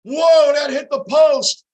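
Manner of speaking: loud, high burst at the near goal; excited shout. Real, not acted. Fan yelling at the TV as the puck hits the post, excited. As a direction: jump up yelling at the shot.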